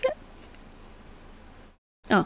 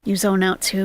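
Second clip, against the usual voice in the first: ordinary talk, nothing marked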